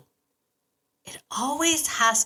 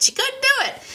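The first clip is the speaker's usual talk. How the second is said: a much higher, tiny teasing voice mocking herself; squeaky mock voice; 3 dB louder, faster than usual. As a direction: squeak it like a cartoon tease. Mocking herself after failing to say it.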